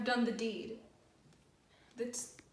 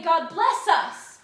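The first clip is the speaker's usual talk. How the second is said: God bless us much louder and slower, bless dragged out and falling; anguished loud prayer; 4 semitones higher, 12 dB louder, slower than usual. Retold or imitated. Macbeth reliving the prayer he could not answer, loud and slow. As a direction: wring the words out loud and slow. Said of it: "when they did say".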